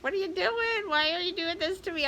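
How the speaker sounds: high-pitched frantic dog voice, imitating; voicing the panicked dog. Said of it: "she was freaking out, like".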